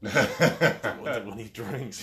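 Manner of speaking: high, slow, laughing voice; laughing through mock Hulk. Joking to the host about being an angry Hulk, laughing. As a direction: crack up mid-line.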